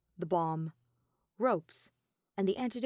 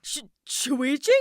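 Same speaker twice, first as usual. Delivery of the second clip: stammering in disbelief, higher and louder, slowly; stunned to see him there; breathless astonishment; shocked stammer; 6 semitones higher, 7 dB louder, slower than usual. A narrator's reading. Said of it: "Kaito gaped at him in shock".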